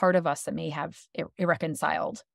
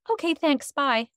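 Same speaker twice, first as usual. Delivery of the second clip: meek quick goodbye; 7 semitones higher, slower than usual. Pretend. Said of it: "just say".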